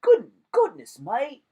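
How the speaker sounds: high, loud mock British accent, hammed up; bad British accent. Shown as a demonstration. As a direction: play a clumsy Brit with a squeaky voice. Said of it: "I don't know how to do any accents".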